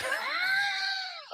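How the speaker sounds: long high-pitched laugh; real laugh. Real, not acted. Host cracking up at his own family story, real high laugh.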